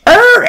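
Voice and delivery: mock startled yell. Kid yells at the RV.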